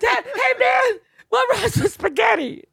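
high-pitched tearful plea, hammed up; crying rapper, mock. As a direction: beg for a rhyme in a squealing mock sob. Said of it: "crying, rapping, too".

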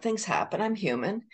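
ordinary delivery

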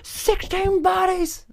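very high, slow drawn-out cry; mock preacher Loomis. Loomis preaching doom, slow high mock wail. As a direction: stretch it like a doomsday sermon. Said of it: "reminded me of that".

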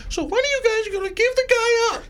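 squeaky high mock voice; lady mimicked way up high; high-pitched imitation. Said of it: "'cause of her voice".

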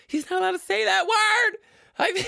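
high-pitched mock outrage; offended listener. Imagined listener objects to a banned word, mock outraged. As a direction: squeal in put-on offence.

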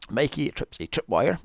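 ordinary delivery

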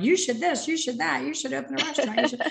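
high-pitched mock bossy advice; playful imitation of a busybody advisor; bossy self-mockery. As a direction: rattle off shoulds in a high, self-teasing voice. Mimicking herself bossing everyone with shoulds.

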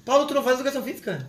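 high, fast teasing question. Pretend. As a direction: needle him playfully. Teasing friend.

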